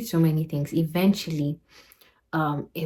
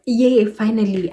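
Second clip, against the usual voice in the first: higher, louder retold cheer; happy cheer; 5 semitones higher, 6 dB louder than usual. Traveler told she is finally going home, happy retold cheer. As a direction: re-enact celebrating a flight home. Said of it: "see me happy that we".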